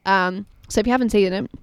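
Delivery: ordinary talk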